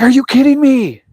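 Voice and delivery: stunned son reacting to the award; incredulous.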